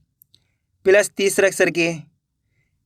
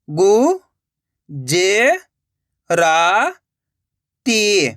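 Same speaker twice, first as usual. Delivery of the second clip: syllable by syllable; much slower than usual. Shown as a demonstration. Teacher sounding out a word for learners, syllable by syllable. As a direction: separate drawn-out syllables with long gaps. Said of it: "उच्चारण करके ही पढ़ सकते हो".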